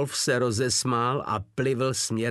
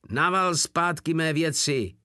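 louder, higher, beside himself; furious outburst; 7 semitones higher, 4 dB louder than usual. A narrator's reading. Wolf demanding his goods back, furious outburst. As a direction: blow up, demanding your things back. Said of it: "vybuchl Wolf z teky a už zcela bez sebe".

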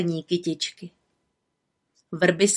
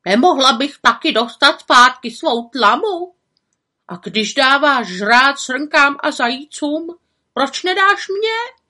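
pleading fox; 7 semitones higher, 6 dB louder than usual. Acted. Fox begs the little witch for food.

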